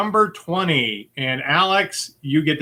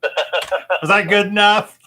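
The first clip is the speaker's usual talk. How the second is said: laughing; 6 semitones higher, slower than usual. Real, not acted. After his accent attempt.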